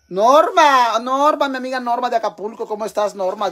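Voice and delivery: calling out greeting. Real, not acted. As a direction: holler to a friend across the way. Llama y saluda a su amiga. (saludo a gritos, agudo y efusivo)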